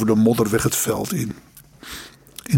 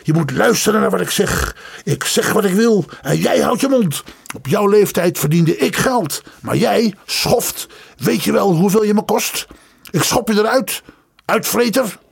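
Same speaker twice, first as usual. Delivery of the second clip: berating; 8 semitones higher than usual. Acted. Father berates son.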